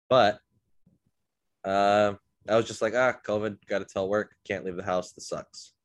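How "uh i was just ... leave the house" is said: usual speaking voice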